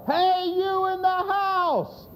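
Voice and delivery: high, drawn-out holler for help; yelling. Retold or imitated. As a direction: yell from far off toward the house. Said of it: "he's yelling down at that house".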